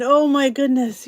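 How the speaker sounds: mid-yawn. Real, not acted. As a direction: say it through a yawn. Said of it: "I yawn".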